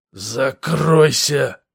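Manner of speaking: hissed out slow, gurgling. Acted. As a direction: hiss it through a gurgling pipe. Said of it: "прошипел, пробулькал лейтенант".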